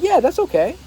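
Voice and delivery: lifted, friendly reassurance; kind and bright. Shown as a demonstration. Reassuring the cashier, friendly and kind. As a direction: sound glad to help. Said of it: "It's the tonality, it's how you say it".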